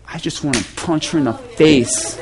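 low, hushed mock menace; confidential threat. Volunteer confides to pastor about the woman, hushed. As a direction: confide it softly, as a secret urge.

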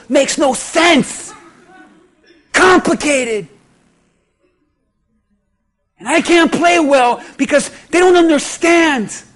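complaint building from grumble to a shout; frustrated husband. Retold or imitated. Husband tells the pastor about his marriage, building to a shout. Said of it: "You say".

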